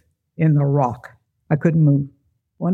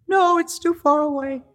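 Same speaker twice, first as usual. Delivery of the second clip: scared; 10 semitones higher than usual. Retold or imitated. Frozen on the rock, pleading.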